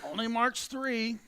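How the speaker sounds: slow, high announcer call; drawn-out call. Real, not acted. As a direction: stretch each word out.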